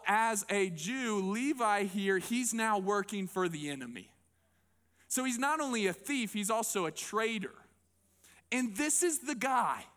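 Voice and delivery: usual speaking voice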